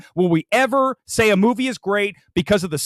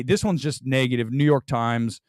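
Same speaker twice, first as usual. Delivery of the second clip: normal talking voice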